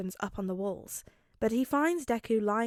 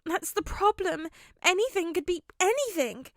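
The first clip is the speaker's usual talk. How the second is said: put-on fretting boy voice; 8 semitones higher than usual. A narrator's reading. Midoriya frets.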